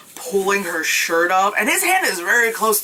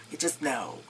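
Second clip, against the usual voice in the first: much softer, dry sarcastic echo; sarcastic aside; 4 semitones lower, 12 dB quieter than usual. Shown as a demonstration. Mocking the movie's logic, a soft dry echo. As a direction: mutter it back, mocking the plot.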